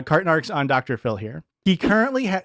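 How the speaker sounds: ordinary talk, nothing marked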